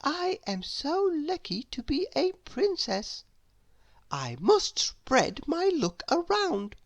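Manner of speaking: high, bright princess voice, slowing down; sweet storybook princess talking to herself. Acted.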